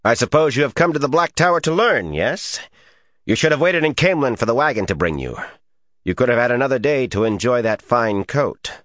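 put-on high, fast, sneering Tarabana accent. A narrator's reading. Sneering man belittles new arrival Rand, in a Tarabana accent. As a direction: talk down to a newcomer in a Tarabana accent. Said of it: "he demanded in a Tarabana accent".

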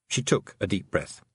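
plain reading voice

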